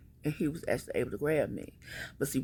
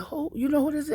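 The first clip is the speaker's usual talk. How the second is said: much higher, louder pressing question; urgent question; 13 semitones higher, 5 dB louder, faster than usual. Retold or imitated. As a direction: demand an answer fast. Officer questions her about stalker.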